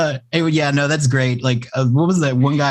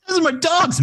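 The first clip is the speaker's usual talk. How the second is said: mock high voice. Joking about dog squeaking.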